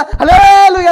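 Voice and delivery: a full-throated hallelujah, high and loud; loud hallelujah shout. Retold or imitated. Preacher imitating a worshipper, shouting hallelujah loud. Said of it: "అని గట్టిగా అరుస్తున్నావు".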